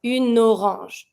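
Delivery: Une orange, slow, N linked into the O; careful French une orange. Shown as a demonstration. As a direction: link the N of Une into orange slowly. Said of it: "link your N with the O".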